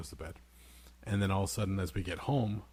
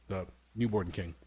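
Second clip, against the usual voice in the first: ordinary delivery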